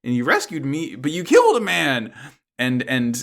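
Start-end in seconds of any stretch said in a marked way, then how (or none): 0.0-2.1 upset Mirin quote. Retold or imitated. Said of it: "Mirin's like".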